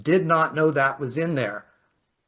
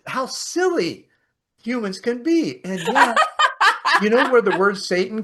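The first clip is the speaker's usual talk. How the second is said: high, loud, laughing through the words; can barely talk for laughing; 11 semitones higher, 8 dB louder than usual. Real, not acted. Laughing at the absurd story.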